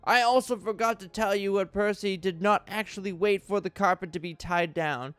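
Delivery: usual speaking voice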